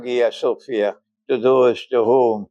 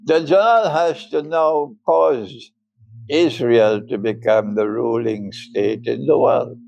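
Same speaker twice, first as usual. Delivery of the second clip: ordinary talk, nothing marked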